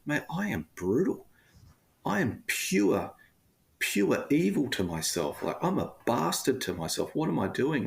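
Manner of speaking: lightly voiced inner bully berating himself; harsh inner critic. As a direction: play the bully in your head, a touch exaggerated. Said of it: "I'm like".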